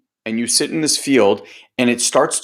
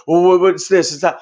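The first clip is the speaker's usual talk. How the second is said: higher, louder mock questioning; imitating curious people; 5 semitones higher, 4 dB louder than usual. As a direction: mimic people pressing for her secret. People quizzing her on fitness.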